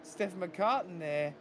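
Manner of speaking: high, excited race call; motorsport commentator. Acted. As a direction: call the race start live. Live race play-by-play.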